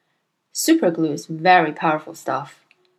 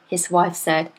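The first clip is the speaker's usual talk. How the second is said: ordinary delivery, nothing marked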